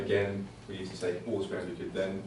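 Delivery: usual speaking voice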